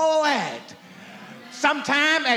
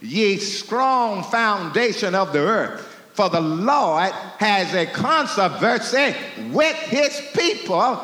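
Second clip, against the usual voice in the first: lower, measured King James reading; scripture reading; 4 semitones lower than usual. Acted. Preacher reading Micah to the congregation, lower and measured. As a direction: intone the verses from the pulpit.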